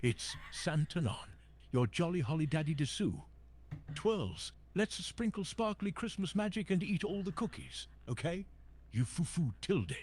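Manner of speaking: put-on high-pitched, sparkling VTuber girl voice; kawaii VTuber girl. Shown as a demonstration. Santa doing a requested VTuber impression, high, sparkling kawaii voice. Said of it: "impression of, like, a super kawaii".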